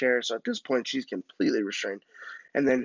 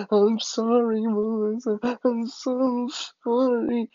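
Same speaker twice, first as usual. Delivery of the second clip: mock crying apology; 11 semitones higher, slower than usual. Boy apologizing to tied-up Melissa, mock bawling. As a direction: wail it like a bawling child. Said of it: "because they see me crying".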